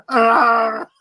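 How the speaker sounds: loud, high mock pirate "Arr"; hammed-up growling Arr; mock Scottish growl. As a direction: bark it like a cartoon Scot. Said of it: "It's a Scottish style".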